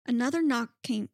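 normal talking voice

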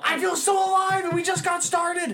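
high, loud, overjoyed yell; giddy shout. Acted. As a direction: shout it like you're truly alive. Repeats line as a giddy shout.

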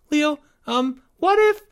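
put-on very high, halting child voice; hesitant young boy. A narrator's reading. Timmy afraid to warn his friend, squeaky and hesitant. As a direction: squeak it out uncertainly.

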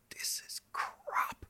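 breathy unvoiced whisper. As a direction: lean in and whisper it. Whispered aside to George.

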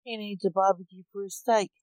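ordinary delivery

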